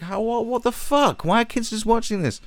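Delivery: mocking stunned BBC execs; mock baffled executive. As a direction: play a flustered TV boss. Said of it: "And they're like".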